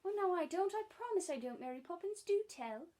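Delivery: high, loud earnest pleading. Acted. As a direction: beg her to tell. Children pleading with Mary Poppins.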